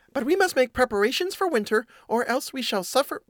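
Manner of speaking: high-pitched little mouse voice; mouse voice by mistake. Acted. Urging winter preparations to the housemate, in a high mouse voice. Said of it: "oh, that's the cat".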